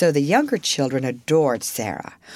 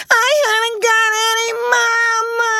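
loud, very high crying wail; wailing child; 5 dB louder than usual. A narrator's reading. Spoiled little girl wailing for her mama.

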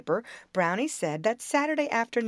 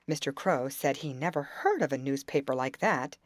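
usual speaking voice

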